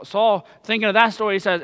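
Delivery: ordinary talk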